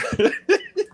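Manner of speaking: a real laugh that bursts out high; high burst of laughter. Real, not acted. Laughing at the joke about spiked coffee, high burst of laughter. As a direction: let a laugh break out, high and sudden.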